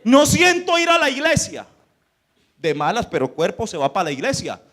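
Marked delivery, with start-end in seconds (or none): 0.0-1.7 protesta imitada, aguda y fuerte; excusa quejosa. Acted. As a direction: sube la voz como quien se niega a ir. Voicing someone's excuse to skip church. (queja en voz alta, imitando al desganado)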